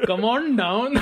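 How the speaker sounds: a light, laughing hint of an Indian accent on the ad line. Asked by a friend to redo the ad, a mild Indian accent through laughter. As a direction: toss off the pitchman line in a half-hearted Indian accent while laughing. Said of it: "do it like a Western Indian accent".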